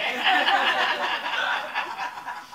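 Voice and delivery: high loud burst of laughter. Real, not acted. Laughs at her own story.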